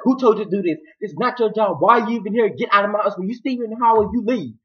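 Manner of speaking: high, heated telling-off; acted angry scolding. Man ordering Hannah out of his house, angry scolding. Acted quarrel, throwing her out.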